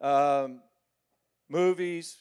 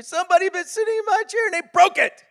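very high quick cub voice, loud; tiny bear upset his chair is broken; baby bear; 12 dB louder, faster than usual. Acted. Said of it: "Little baby bear".

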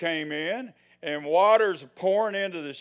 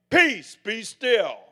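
loud, slow, ringing proclamation; proclaimed; 4 semitones higher, 8 dB louder, much slower than usual. Shown as a demonstration. Preacher showing congregation how to proclaim peace, loud slow proclamation. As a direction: declare it with full authority. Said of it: "But when you proclaim".

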